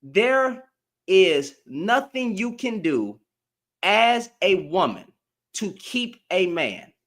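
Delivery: slow, phrase by phrase, with firm pauses; deliberate, spaced-out declaration; emphatic repeat. Real, not acted. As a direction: spell it out slowly so nobody misses it. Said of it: "say it one more time".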